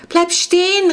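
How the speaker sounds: high, loud, breathless warning cry; panicked girl calling her brother to stop. Acted. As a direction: shout it out of breath, voice nearly cracking. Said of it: "rief Clara atemlos".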